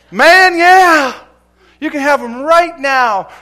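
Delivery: high, loud, delighted reply; joyful yes. Retold or imitated. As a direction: burst out with glad permission. Preacher's joyful answer retold.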